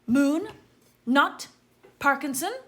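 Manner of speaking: loud, high roll-call announcement; calling out names. A narrator's reading. Professor calling the next pupil loudly to the stool. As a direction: call each name across the hall.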